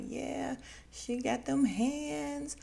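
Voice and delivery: high, slowed teasing voice; mock hype. Recapping the show, imitating Famous hyping up the girl. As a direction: drawl it out like an impressed friend. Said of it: "Famous is like".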